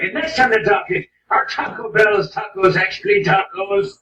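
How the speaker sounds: loud, high mock Irish accent. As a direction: demand it in a put-on Irish accent. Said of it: "That's one of my Irish accents".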